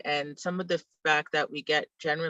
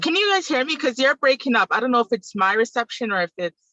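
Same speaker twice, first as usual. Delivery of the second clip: raised voice, higher pitch; calling out; 8 semitones higher, 7 dB louder than usual. Real, not acted. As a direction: speak up over a bad line. Calling out over bad connection.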